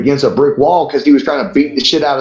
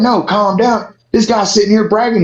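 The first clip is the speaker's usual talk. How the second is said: higher, heated pushback; indignant retort; 8 semitones higher than usual. Retold or imitated. Retelling telling the crowd to calm down, indignant. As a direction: snap back that he's the problem. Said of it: "I was like".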